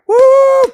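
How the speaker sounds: very high, loud woo; explosive hype whoop; loud whooping cheer. Real, not acted. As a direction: burst into a piercing whoop. Cheering for gifts.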